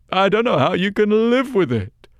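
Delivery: put-on high, loud, tearful old crone; weepy old woman. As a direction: cry it out like a grieving old woman.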